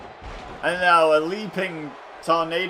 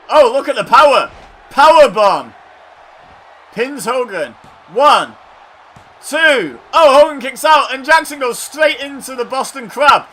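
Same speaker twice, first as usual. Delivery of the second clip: excited shouting; 9 semitones higher, 12 dB louder than usual. Real, not acted. Power bomb and near fall.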